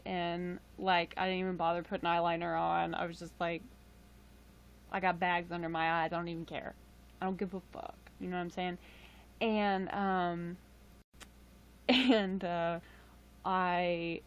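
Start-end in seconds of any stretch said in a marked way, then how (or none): none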